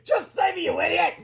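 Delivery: high, exasperated shriek; screamed. A narrator's reading. Woman screams at superhero to save her. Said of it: "he screamed".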